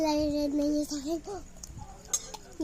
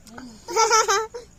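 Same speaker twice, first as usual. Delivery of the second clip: pained laugh; 5 semitones higher, 7 dB louder than usual. Real, not acted. Laughing at the spicy burn.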